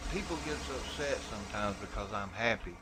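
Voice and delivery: ordinary talk, nothing marked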